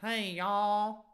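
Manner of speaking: high, loud drawn-out Texan twang; Texan girl accent. Acted. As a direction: pitch it up as a Southern American girl from Texas. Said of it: "what's a girl sound like with".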